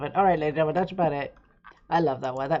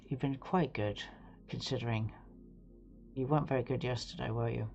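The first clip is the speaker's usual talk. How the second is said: tender to the bird; 4 semitones lower, 5 dB quieter than usual. Real, not acted. Talking softly to his bird.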